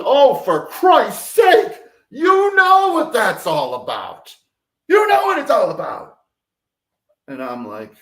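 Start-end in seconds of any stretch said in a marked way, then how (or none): 0.0-6.2 high, loud, slow exasperated outburst. Retold or imitated. Mother snapping at her son who asks about the light, exasperated outburst. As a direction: snap back at a question with an obvious answer. Mother snaps at son's question.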